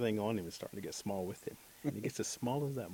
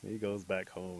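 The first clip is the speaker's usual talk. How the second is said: usual speaking voice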